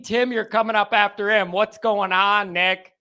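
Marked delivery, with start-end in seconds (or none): none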